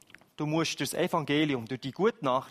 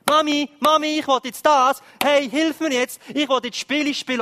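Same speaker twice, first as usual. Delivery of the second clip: put-on high, loud whiny child pestering; loud high kid begging mum at the coffee table; demanding child; 9 semitones higher, 10 dB louder than usual. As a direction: play a kid tugging at mum for attention. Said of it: "dann kommt ein Kind und sagt".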